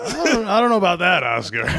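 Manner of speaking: skeptical Shannon Sharpe voice; Shannon Sharpe impression. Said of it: "And Shannon Sharpe's like".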